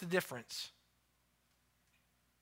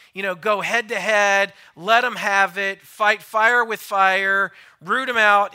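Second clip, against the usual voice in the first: loud, rising combative mock advice; mock fight talk; 3 semitones higher, 11 dB louder than usual. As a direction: hammer out the wrong advice like a fight coach. Preacher mocks fighting advice loudly.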